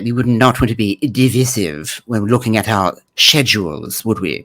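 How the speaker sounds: hammed-up British accent; bad British accent. Host mocking how the man says divisive, bad British accent. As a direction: put on a bad, proper British voice. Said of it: "He turned into my bad British accent".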